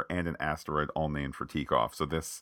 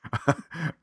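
laugh; 8 semitones higher than usual. Real, not acted. Laughing at TikTok joke.

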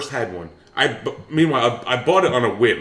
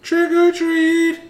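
put-on very high, slow sing-song kid voice; high kid trick-or-treater; 6 dB louder, much slower than usual. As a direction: chirp it like a tiny kid at the door.

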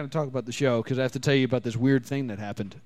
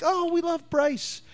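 high fan voice; 12 semitones higher, slower than usual. Retold or imitated. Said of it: "they were all like".

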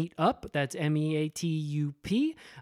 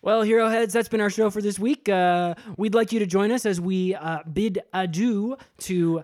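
upbeat host sign-off; 4 semitones higher, 5 dB louder than usual. Acted.

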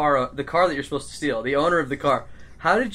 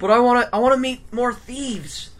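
eager pleading; 9 semitones higher than usual. Acted. Tigawog begging to stay along.

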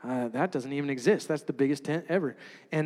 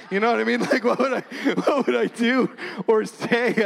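higher, louder, laughing through it; 7 semitones higher, 6 dB louder than usual. Real, not acted. Telling the church his embarrassment, laughing loudly. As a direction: laugh as you ask.

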